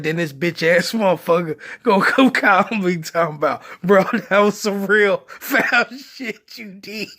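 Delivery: quoting through real laughter; laughing to tears. Real, not acted. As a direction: crack up while telling the story. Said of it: "like, literally crying on".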